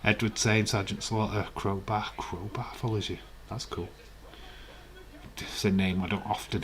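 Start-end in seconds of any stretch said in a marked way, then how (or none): none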